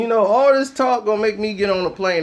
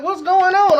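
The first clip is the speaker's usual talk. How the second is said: higher puzzled question; startled higher what's going on; confused; 8 semitones higher, 3 dB louder than usual. Retold or imitated. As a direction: ask it high and baffled. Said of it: "I'm like".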